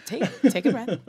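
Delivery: laughing warmly while reassuring. Real, not acted. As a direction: laugh through the words. Host laughing, reassuring guest.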